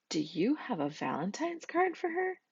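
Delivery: a touch of Miss Skunk. A narrator's reading.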